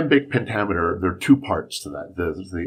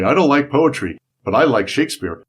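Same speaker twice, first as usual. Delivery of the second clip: louder, higher mock protest; imitating a skeptic; 6 semitones higher, 6 dB louder than usual. Quoting people who say they hate poetry, mock indignant. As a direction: play the poetry hater, a bit indignant. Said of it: "people who say".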